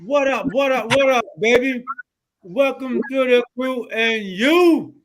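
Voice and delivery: loud, high hype-man welcome; hype host intro. Acted. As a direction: fire up the audience, slow on the welcome. Host opens the show.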